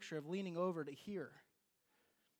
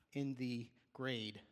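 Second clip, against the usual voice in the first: normal talking voice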